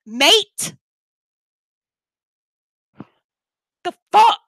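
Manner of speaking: fed-up, forceful telling-off; real angry rant. Real, not acted. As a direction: tear into them, exasperated and incredulous. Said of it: "I'm sorry, I'm angry".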